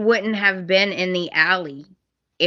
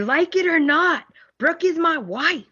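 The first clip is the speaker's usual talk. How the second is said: much higher mock declaration; mock Ridge; 9 semitones higher than usual. Ridge declaring Brooke his wife, hammed up high. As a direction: ham up the husband's vow. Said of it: "He's like".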